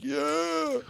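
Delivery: a breathy high little yeah, playing it cool; soft, very high mock yeah; mock reply. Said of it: "You're like".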